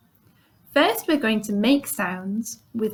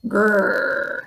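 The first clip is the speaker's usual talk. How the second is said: growl. Shown as a demonstration. Teacher growls for the class, playful growl. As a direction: growl like a friendly bear. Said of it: "I can growl".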